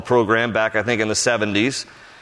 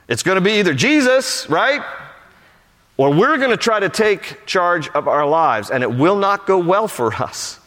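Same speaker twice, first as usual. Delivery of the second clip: raised-pitch emphatic preaching; 7 semitones higher than usual. Real, not acted. Preacher to congregation on who's in charge, emphatic preaching. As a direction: drive the point home to the congregation.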